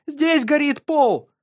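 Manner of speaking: high, slow, loud cry; panicked shout. Acted. Mother shouts about fire.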